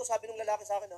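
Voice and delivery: normal talking voice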